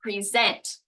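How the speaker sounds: "present" as pree-ZENT, second syllable punched; stress on second syllable. Shown as a demonstration. Teacher contrasts two meanings for learners, stress on second syllable of "present". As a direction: land firmly on the end of "present", pree-ZENT. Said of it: "depending on how I say it".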